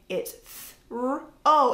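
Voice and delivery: put-on child sounding out. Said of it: "they can go".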